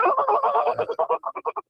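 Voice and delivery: high airy imitated laugh; imitating Polo's squeaky laugh. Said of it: "and he go".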